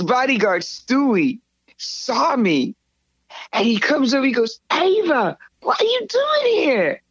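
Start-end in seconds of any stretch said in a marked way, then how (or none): 4.7-7.0 high, drawn-out mock astonishment; astonished greeting. Bodyguard spots her in the club, astonished. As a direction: imitate a stunned man, pitched way up.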